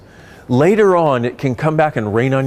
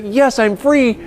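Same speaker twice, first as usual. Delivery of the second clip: higher-pitched mock triumph; acted elation; 10 semitones higher, slower than usual. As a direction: voice someone's relieved little victory cry. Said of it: "and you feel like".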